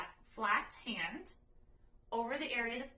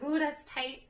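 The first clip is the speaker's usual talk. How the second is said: lightly voiced dog's reaction, higher and louder; a brief, louder "ooh" on the dog's behalf; voicing the dog; 5 semitones higher, 7 dB louder, slower than usual. Trainer speaks for the dog being massaged, lightly voiced reaction. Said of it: "She's like".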